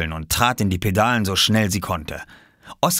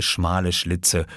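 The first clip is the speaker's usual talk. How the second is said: usual speaking voice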